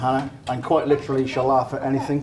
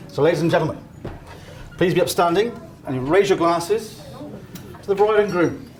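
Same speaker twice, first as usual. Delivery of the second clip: wedding toast speech; 5 semitones higher than usual. Acted. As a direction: raise the glasses, voice lifting high. Raising a toast to the couple.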